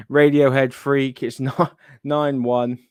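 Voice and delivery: laughing through the score; chuckling in disbelief. Real, not acted.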